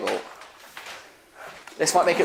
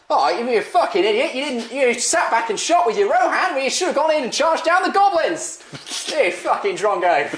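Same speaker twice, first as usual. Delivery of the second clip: put-on Lockie in Australian accent; 9 semitones higher than usual. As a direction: berate him like an exasperated Aussie mate. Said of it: "is this a good impression of Lockie".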